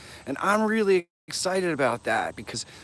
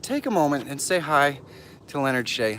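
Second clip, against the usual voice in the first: ordinary talk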